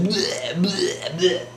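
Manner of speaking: repeated high mock retches; imitated puking. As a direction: act out heaving over and over. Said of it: "like, puking their guts out".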